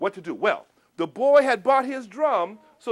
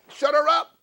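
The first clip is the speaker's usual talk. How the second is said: punchline; 5 semitones higher, 7 dB louder than usual. Acted. Punchline of potion wish.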